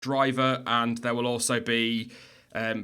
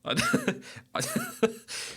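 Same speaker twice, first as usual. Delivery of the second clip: high laugh. Real, not acted. Laugh after "can you tell.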